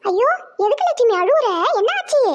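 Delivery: high, startled worried ask; alarmed concern. Acted. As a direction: rise up in sudden worry. Grandmother sees daughter-in-law cry.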